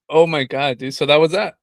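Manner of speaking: ordinary delivery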